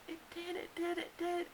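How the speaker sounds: high, soft, tearful insisting; near tears. Retold or imitated. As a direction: plead it, close to crying. Nurse doubts her water broke.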